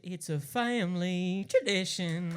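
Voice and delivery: slow, loud, drawn-out proud shout; defiant proud declaration. Retold or imitated. Replying to grandpa, slow proud shout. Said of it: "I said".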